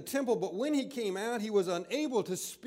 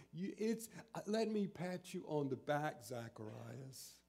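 mock gentle comfort; 7 semitones lower, 9 dB quieter than usual. Preacher mocking a soft angel consoling Zacharias, hushed and sweet. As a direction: coo it softly like a mock pat on the back. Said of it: "does not say it like this".

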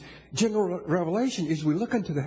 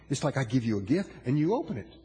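ordinary talk, nothing marked

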